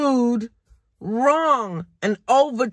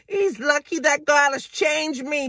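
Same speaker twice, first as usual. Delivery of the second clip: normal talking voice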